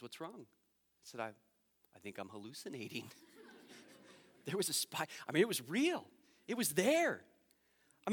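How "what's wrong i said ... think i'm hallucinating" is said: hushed retelling. Retold or imitated. Hushed midnight exchange.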